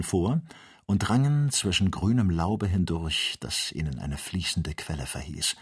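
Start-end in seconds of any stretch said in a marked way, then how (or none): none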